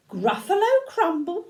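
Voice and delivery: high, rising, incredulous; lightly acted storybook alarm; alarmed echo. A narrator's reading. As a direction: repeat it as a shocked question. Gruffalo echoes it in alarm.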